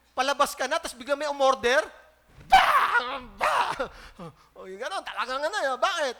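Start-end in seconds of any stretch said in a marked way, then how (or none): 2.5-3.9 sudden loud high burst, half laughing; mock angry outburst. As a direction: blurt it like an annoyed worker. Mock angry worker reaction. (kunwaring galit na sigaw)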